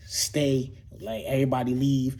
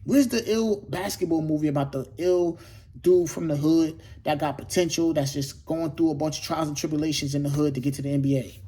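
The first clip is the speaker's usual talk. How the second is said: normal talking voice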